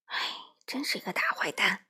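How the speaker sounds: sighing, hushed complaint; vexed, dejected. A narrator's reading. Crow talking over the ordeal, hushed and dejected. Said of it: "花袜子懊恼地说".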